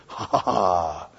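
very low, slow, drawn-out chuckle; excited anticipation. Acted. Preacher playing Elijah as the wind starts, excited anticipation. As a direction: relish what is coming with a deep, slow ha-ha. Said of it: "He's all excited".

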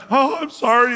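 half-hearted wail of apology; mock weeping. Saul weeping to David, mock tearful. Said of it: "he lifted up his voice and he wept loudly and cried".

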